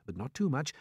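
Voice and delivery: ordinary delivery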